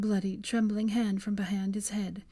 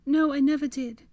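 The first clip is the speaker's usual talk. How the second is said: higher, louder insistence; insistent; 7 semitones higher, 5 dB louder than usual. Acted. Hermione insists to dying Draco, tearful. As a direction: insist through tears. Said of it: "she insisted".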